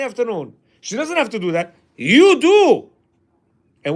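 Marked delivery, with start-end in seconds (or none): 2.0-2.9 emphatic. Real, not acted. Punchline to the husband.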